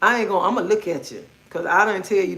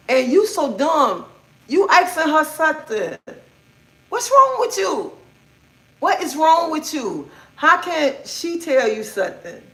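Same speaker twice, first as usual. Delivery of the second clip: retold exasperated scolding; 8 semitones higher than usual. Retold scolding of married friend.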